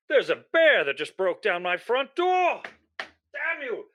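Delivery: ordinary talk, nothing marked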